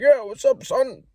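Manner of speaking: put-on high, loud old-timer greeting; old man's voice. Old man at the gym answering, high and loud. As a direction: play the old gym guy. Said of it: "he's like".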